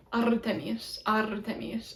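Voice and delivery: "Artemis" with a trilled r, softly; rolled R. Shown as a demonstration. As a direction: roll the r quietly in "Artemis". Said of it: "much better rolled R".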